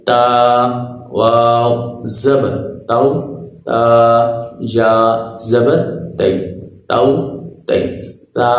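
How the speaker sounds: put-on teacher drilling spelling for children. Shown as a demonstration. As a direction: spell each letter, then blend it. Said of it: "ہجے بھی آپ نے سیکھ لیے".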